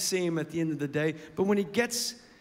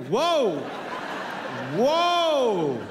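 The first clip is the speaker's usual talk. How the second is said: loud, rising then falling drawn-out whoa; groggy waking groan; 5 dB louder than usual. Retold or imitated. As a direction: stretch it out like a kid just waking. Son waking up.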